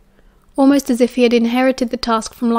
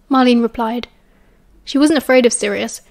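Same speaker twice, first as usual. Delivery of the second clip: plain reading voice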